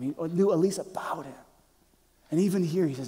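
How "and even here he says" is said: usual speaking voice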